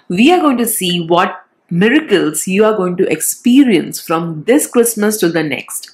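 ordinary talk, nothing marked

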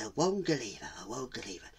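soft, repeated chant to oneself; quiet rote repetition; self-reminder. Acted. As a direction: murmur the word to memorise it. Tortoise memorising softly.

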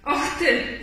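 mildly acted outburst; angry. A narrator's reading. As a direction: scold in a storybook rabbit's temper. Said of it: "zezłościł się królik".

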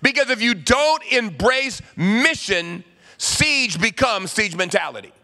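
urgent call. Retold or imitated. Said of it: "He said".

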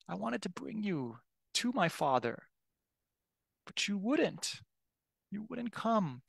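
high, soft, sorrowful plea; pleading lament. Retold or imitated. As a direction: plead gently with someone who refused you. Voices Jesus lamenting over Jerusalem.

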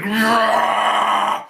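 mock rage roar. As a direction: bark it like a red-faced brawler, half in jest. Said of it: "both of them red and just like".

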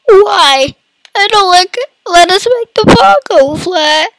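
high-pitched mock whining sobs; fake crying. Gamer moaning that the ball won't go flat, fake crying. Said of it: "I'm fake crying if you can't tell".

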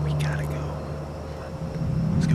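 urgent hushed whisper; whisper, scared of being caught. Real, not acted. Hiding from security guard.

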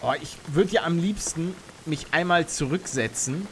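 usual speaking voice

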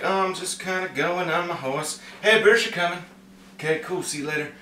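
soft, casual, carefree amble; mock happy-go-lucky. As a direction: say it like idle small talk, in no hurry. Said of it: "This was not like a happy".

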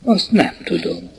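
mumbled evasive I-don't-know; meek denial. A narrator's reading. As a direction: shrink away from the question. Suspect evades the questioning.

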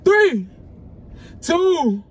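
high, loud called-out count; loud countdown. Real, not acted. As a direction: call the count out to the room. Counting down to the reveal.

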